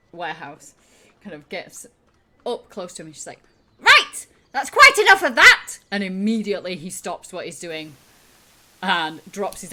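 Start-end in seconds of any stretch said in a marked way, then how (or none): 3.8-5.8 very loud, high-pitched telling-off; high, booming order to stop; scolding shout. Acted. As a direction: shout them down at once. Shrilt scolds Gem to stop.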